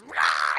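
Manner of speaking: very low, loud growling roar; monster roar. Retold or imitated. The furball snaps at Jen's hand, very low loud monster roar. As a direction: roar with a sudden gaping maw. Furball shows giant mouth.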